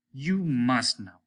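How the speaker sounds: slow, firm; slow stubborn demand; insisting. A narrator's reading. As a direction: lean on each word. Captain insists.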